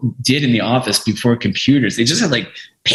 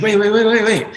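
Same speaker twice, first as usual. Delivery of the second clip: rapid, higher-pitched string of words; urgent interruption; 11 semitones higher than usual. Retold or imitated. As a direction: cut in excitedly, rattling it off. Interrupts him on being handed package.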